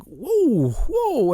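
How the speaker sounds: high, loud swooping exclamation; shocked whoa. Retold or imitated. Reacting to a severed finger.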